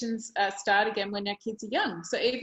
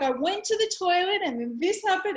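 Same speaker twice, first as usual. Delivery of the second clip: excited; 9 semitones higher, 4 dB louder than usual. Retold or imitated. Daughter explaining to her mum.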